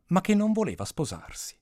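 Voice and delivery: ordinary delivery, nothing marked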